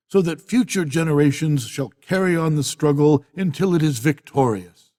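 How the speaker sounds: ordinary talk, nothing marked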